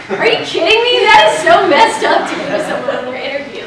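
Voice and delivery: half-laughing, half-furious at the prank; indignant disbelief. Retold or imitated. Candidate to GM after the prank, laughing indignant outburst. Shocked reply to the interview prank.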